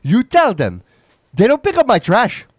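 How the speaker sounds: high, loud, nagging mom voice; terrible mom impression. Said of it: "That's a terrible accent of my mom".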